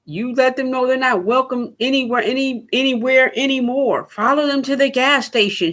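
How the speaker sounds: hammed-up fiery speech; mock ranting. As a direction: parody of a firebrand urging the crowd. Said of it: "she said".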